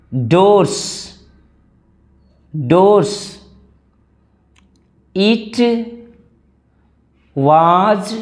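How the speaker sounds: word by word, with long gaps between words; slow model reading. Acted. Teacher reads the story slowly for students to repeat, word by word. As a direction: read the passage one word at a time.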